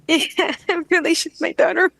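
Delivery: loud, high, overcome laughing voice; laughing with emotion. Real, not acted. Client recognizes her daughter in a past life, laughing with emotion. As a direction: let real laughter break through the words.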